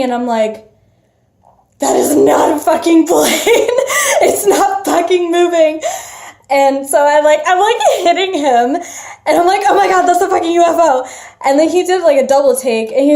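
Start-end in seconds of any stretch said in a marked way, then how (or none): 1.8-5.8 higher, louder, laughing insistence; retold exasperated disbelief. Speaker to companion who calls it a plane, exasperated disbelief. 9.7-11.0 fast, high, loud alarm; retold shocked outburst. Speaker hitting companion at the sighting, shocked outburst.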